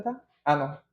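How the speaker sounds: usual speaking voice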